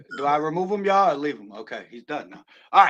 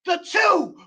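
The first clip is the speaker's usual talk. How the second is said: high shouted pin count; excited count; 12 semitones higher than usual. Acted. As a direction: yell the count as the crowd would. Counting the pinfall.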